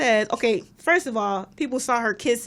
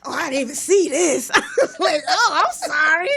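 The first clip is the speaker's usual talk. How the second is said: high, louder mock surprise; mock apology; 6 semitones higher, 4 dB louder than usual. Co-host retells teasing a man, high mock-apologetic. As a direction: act it out high and loud, laughing. Retelling an exchange, acted mockingly.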